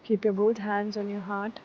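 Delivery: calm, unhurried meditation guide; guided meditation. Acted. Teacher guiding students into seated meditation, calm. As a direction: settle them into stillness.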